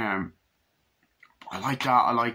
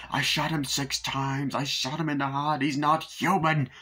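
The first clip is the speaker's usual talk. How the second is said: agitated Loomis; 4 semitones higher than usual. Retold or imitated. Loomis insists the killer is not human, agitated. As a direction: draw out each dire claim, pitched up. Acting out Loomis's iconic line.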